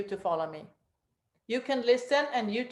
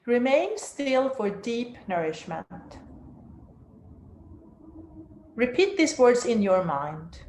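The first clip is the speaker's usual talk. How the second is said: guided meditation. Acted. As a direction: guide them down into stillness.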